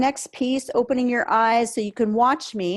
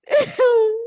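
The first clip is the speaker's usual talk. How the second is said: crying laugh; 11 semitones higher, 5 dB louder than usual. Real, not acted. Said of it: "crying laugh mid release".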